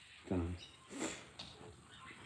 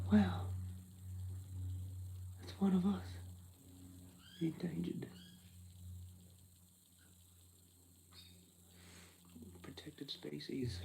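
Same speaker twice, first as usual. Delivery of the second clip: higher, hushed wonder slowing at the end; mock awe; 6 semitones higher, 5 dB quieter than usual. Imagined shopper spotting a fellow Aussie, in mock awe. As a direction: marvel like spotting rare wildlife. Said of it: "You're like".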